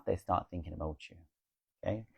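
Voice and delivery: usual speaking voice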